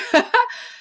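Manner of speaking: quick high real laugh; bright burst of laughter. Real, not acted. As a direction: laugh at herself. Laughs at herself, gardening.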